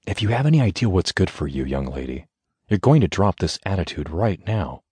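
plain reading voice

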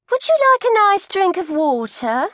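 concerned coat check lady asking a fainting pregnant woman; kindly coat check lady. Acted. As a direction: fuss over her like a kindly auntie.

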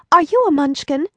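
lightly voiced Dorothy; young girl asking. A narrator's reading. Dorothy asking the old woman, high and curious. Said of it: "asked Dorothy".

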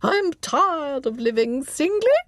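high, slow English nurse reciting verse; swooping, drawn-out English accent; pronounced English accent. A narrator's reading. Nurse reading verse to a child, pronounced English accent. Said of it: "the nurse went on".

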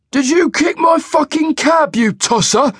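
a big angry cab driver snarling at a pedestrian; snarling cabbie. A narrator's reading. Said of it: "he snarled".

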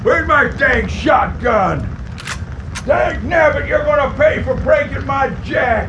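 high, loud furious hollering; angry shout. Acted. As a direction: bellow it out, hunting for the shotgun. Old man finds broken jack.

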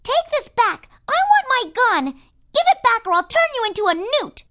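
a small cowgirl yelling to get her toy back; little girl shouting. A narrator's reading. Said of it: "She's shouting".

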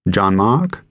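American woman asking, rising at the end; questioning American pronunciation of a French name; American accent. A narrator's reading. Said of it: "A woman with an American accent replied".

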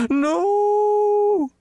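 very high, loud, drawn-out cry; sudden wail. A narrator's reading. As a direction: burst into an anguished wail. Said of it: "he wailed suddenly".

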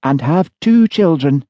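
brisk, businesslike fortune-teller. A narrator's reading.

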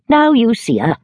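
lightly voiced imperious old lady; Miss Betsy. A narrator's reading. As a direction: declare it with dry authority. Betsy presenting herself.

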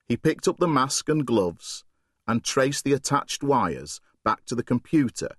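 ordinary delivery

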